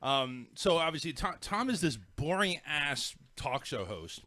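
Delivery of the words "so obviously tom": usual speaking voice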